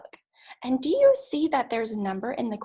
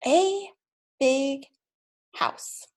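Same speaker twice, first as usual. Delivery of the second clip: word by word; much slower than usual. Shown as a demonstration. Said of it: "Let's try it again".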